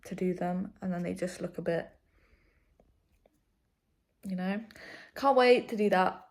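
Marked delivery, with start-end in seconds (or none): 5.1-6.3 loud, eager "Can't wait to do that"; real excitement, loud and high; excited burst. Real, not acted. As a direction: belt it out with glee. Excited about eyebrow appointment.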